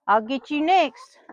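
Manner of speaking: ordinary talk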